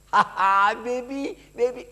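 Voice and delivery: put-on high cooing to a baby; playful parent. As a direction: coo at the baby high and bright. Parents playing with tossed child.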